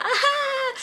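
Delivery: high-pitched mock cackle; imitated laugh. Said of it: "she just laughed like".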